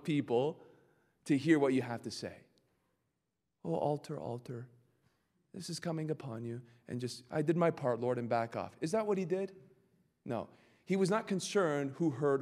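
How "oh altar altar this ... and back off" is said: hushed, meek voice hoping nobody hears; mock timid hush; timid mumble. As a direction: say it under your breath, afraid of the king. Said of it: "Probably to lower your voice as much as possible".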